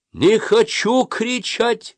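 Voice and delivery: very high, stretched-out shout; protesting yell. A narrator's reading. Boy refuses his leader's order, yelling. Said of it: "закричал я".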